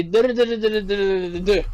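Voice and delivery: a string of even "Duh, duh, duh" that drops at the end; French sentence tune on nonsense syllables "duh, duh"; monotone French rhythm. Shown as a demonstration. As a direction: chant each Duh evenly, letting the last one fall. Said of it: "French is actually pretty monotone".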